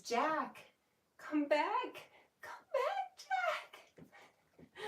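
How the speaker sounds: soaring high desperate falsetto plea; hammed-up Rose crying after Jack; Rose from Titanic. Owner reading a viewer's Titanic joke, hammed-up Rose wail. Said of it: "I relive the Rose and Jack Titanic scene".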